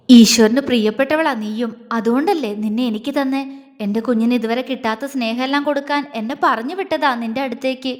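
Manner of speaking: ordinary delivery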